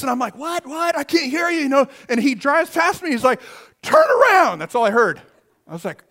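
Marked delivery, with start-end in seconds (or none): 0.4-1.6 calling out. Retold or imitated. Shouting over engine noise. 3.8-4.6 high loud warning yell; urgent shout. Retold or imitated. Friend racing past, high urgent warning shout.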